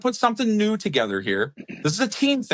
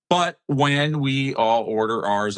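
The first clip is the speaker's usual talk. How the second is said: normal talking voice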